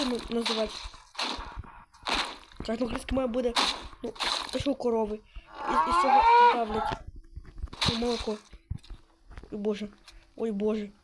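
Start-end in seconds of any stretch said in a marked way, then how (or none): 5.7-6.9 loud outburst. Real, not acted. Loud outburst while fumbling for word.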